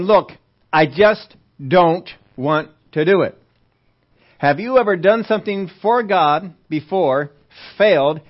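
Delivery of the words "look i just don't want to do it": slow, loud, word-by-word refusal; emphatic refusal. Acted. Moses telling God he won't do it, slow and emphatic. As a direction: space out each word firmly.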